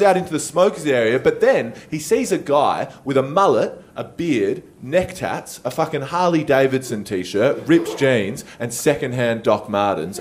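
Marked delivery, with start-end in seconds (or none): none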